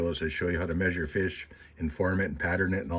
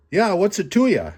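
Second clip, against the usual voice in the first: sassy muskrat; 13 semitones higher, 9 dB louder than usual. Acted. Muskrat talks back with attitude.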